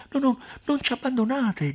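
high, loud, anxious appeal; imploring, pitched right up; urgent pleading. Acted. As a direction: beg them not to leave you. Renzo begs offended Agnese to stay.

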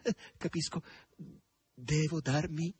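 high, halting, slow question; pensive. A narrator's reading. Margherita works out what the stranger wants, pensive. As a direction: think it out with pauses. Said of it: "disse pensierosa Margherita".

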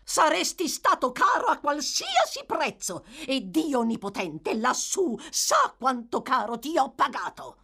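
high, loud scolding wife; shrill shout. A narrator's reading. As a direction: scream it at him, shrill. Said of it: "urlò una voce stridula".

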